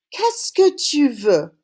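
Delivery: slow, careful Qu'est-ce que tu veux with each syllable spaced out; slow model phrase. Shown as a demonstration. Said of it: "Say it after me".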